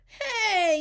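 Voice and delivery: put-on high, loud childlike call; high-pitched protesting shout; kids' yell. A narrator's reading. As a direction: yell it high like a group of kids. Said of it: "yelled the kids".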